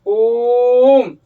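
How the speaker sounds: ओम stretched very long and loud; prolonged pluta vowel. Shown as a demonstration. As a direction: pull ओम out as far as you can. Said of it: "जितना दूर खींच सकते हैं". (ओम को बहुत लंबा खींचकर)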